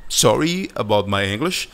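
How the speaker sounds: ordinary delivery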